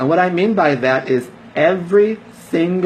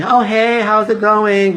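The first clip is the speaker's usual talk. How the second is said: mock friendly greeting; 7 semitones higher, 3 dB louder, slower than usual. Mocking fake small talk.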